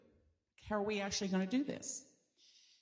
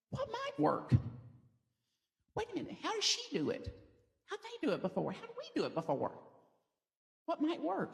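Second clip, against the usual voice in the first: curious inner voice; 9 semitones higher, 3 dB quieter, faster than usual. Retold or imitated. Speaker voicing her highest self searching for solutions, high and curious. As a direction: puzzle it through like a hopeful problem solver.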